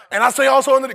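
high, loud recitation; rushed reading. Shown as a demonstration. Preacher mimicking how congregation reads, loud hurried recitation. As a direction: rattle the verse out at full volume. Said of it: "y'all read it like that".

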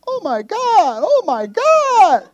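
hammed-up scared shouting; mock panicked yelling. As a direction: shriek in fake terror at the movie. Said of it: "and I'm upstairs".

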